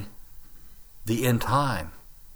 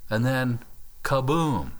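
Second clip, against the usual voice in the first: normal talking voice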